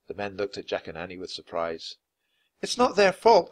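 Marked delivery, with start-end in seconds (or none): 2.6-3.5 high, urgent protest; loud, high girl's defence; said quickly. A narrator's reading. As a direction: jump in at a raised pitch. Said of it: "Annie said quickly".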